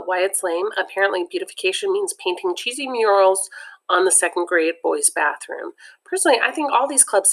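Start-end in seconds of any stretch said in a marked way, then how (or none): none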